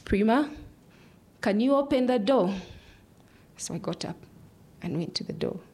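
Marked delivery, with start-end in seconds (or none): 0.0-2.5 raised, higher-pitched call with rising name; retold father calling, pitched up. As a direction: call a child through the window. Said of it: "and called out".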